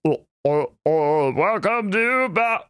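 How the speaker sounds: sung with a pinched, closed-off throat; throaty constricted sung line; closed epiglottis. Shown as a demonstration. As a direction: clamp the epiglottis down as you sing. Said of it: "That's the epiglottis closing down".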